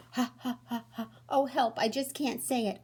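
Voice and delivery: can barely speak for laughing; laughing hard. Acted. Rhino laughing too hard to say his name, laughing fit. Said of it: "I'm laughing so hard".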